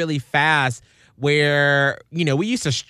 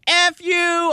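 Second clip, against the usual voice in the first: mock angry caller; 13 semitones higher than usual. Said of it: "people were like".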